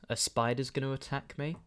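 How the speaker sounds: normal talking voice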